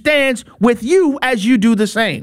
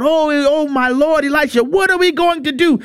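high-pitched, acted-out cry for help; mock panicked cry; 6 semitones higher than usual. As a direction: cry out like a frightened servant. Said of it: "And he cries out".

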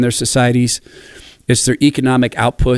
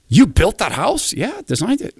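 amazed question; 11 semitones higher than usual. Retold or imitated. Quoted exchange with architect.

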